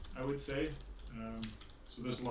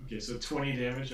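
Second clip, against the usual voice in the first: normal talking voice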